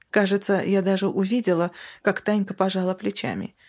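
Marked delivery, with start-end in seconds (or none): none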